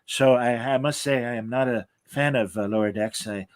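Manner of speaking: put-on a weary old creator murmuring his disapproval; Gene Roddenberry. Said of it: "Majel and I had to".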